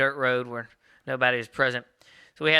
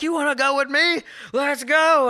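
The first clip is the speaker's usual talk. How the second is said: much higher, louder fighting challenge; picking a fight; 13 semitones higher, 5 dB louder, slower than usual. Retold or imitated. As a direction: square up and dare him. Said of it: "Taylor's like".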